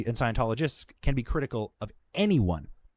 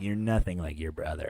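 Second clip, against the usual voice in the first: low, mocking impression of a petty supervisor; annoying trainer voice; 7 semitones lower, 5 dB quieter than usual. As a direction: imitate an annoying guy, pitched down. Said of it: "he had the most annoying fucking voice too".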